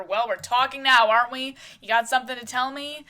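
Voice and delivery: loud challenging shout that eases toward the end; screaming back. Acted. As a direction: hurl the taunt back at his wife. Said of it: "Robert screaming back at her".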